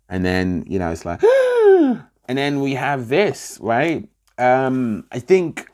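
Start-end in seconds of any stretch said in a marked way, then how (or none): 1.3-2.0 high, loud sucked-in gasp; squealing mock gasp for air; imitated gasp. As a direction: mimic a sharp, desperate intake of breath. Imitates the character gasping for breath.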